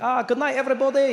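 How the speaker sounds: put-on high, loud Indian-accented waiter. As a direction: call out a farewell in an Indian accent. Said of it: "you, you Indian?".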